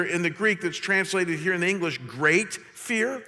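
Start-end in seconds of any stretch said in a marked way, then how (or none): none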